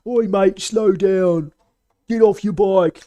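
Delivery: loud, high British geezer yelling; a cockney bloke barking at a cyclist in British accent; angry British street shout. Retold or imitated. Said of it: "he's some British geezer".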